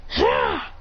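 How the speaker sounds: short, high "ha!"; breathy strike noise on the blow; quick imitated combat yell; mock attack cry.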